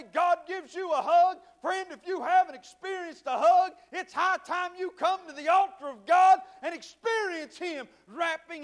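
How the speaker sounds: high, loud, fervent exhortation; full-force preaching. Real, not acted. Preacher calling people to the altar, full-force preaching.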